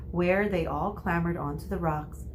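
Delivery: ordinary delivery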